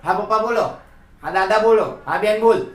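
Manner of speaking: eager "yes, tell me" replies to family; answering a call. Acted. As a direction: answer as if called from the next room. Child answering family members calling.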